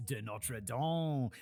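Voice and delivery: slow, drawn-out French name; French accent. Shown as a demonstration. Presenter revealing the famous family name, drawn-out French accent. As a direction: savour the name in mock French. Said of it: "a bit of a French accent".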